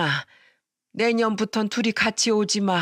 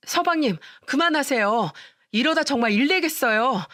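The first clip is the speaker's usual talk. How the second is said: high, urgent pleading cry to stop him; frantic plea; 6 semitones higher than usual. Acted. The narrator, frantic, begs her brother-in-law to stop the beating. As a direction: beg him to stop before it's too late.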